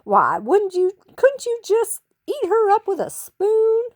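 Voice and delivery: high, loud gushing; gushing Texan lady. A narrator's reading. Eugenia from Texas gushing over Bluebell, high and loud. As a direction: coo over the dog sweetly.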